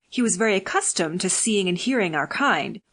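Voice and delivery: ordinary delivery, nothing marked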